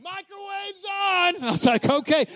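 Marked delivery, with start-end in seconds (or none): 0.0-1.4 drawn-out high call of relief; long, high shout of good news; triumphant shout. Retold or imitated. Someone shouts the power is back. 1.9-2.3 excited okay. Retold or imitated. Relief once the power is back.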